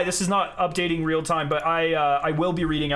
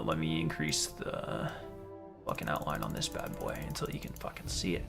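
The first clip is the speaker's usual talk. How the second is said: muttered aside; 11 semitones lower, 12 dB quieter than usual. Real, not acted. Mumbling while adjusting settings.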